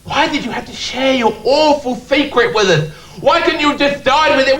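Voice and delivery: high, shouted despairing plea; anguished public. Retold or imitated. Shattered people begging the teller to explain himself, in a high mock wail. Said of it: "pick up the pieces of their shattered lives and say".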